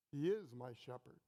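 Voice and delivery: "is" held and weighted, low and firm; stress on IS. Shown as a demonstration. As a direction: lean on "is" in "He is my shepherd". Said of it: "Not was, not might be, not will be".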